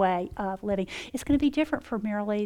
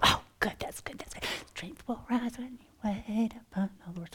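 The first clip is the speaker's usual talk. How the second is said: soft unvoiced whisper, savouring the verse; whispered thought; 11 dB quieter than usual. Recalling her thought at night, whispered. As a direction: breathe it to yourself like a private prayer.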